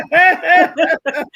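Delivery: a big bright burst of laughter; loud laugh. Real, not acted. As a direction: laugh out loud, high and free. Co-host laughs loudly on air.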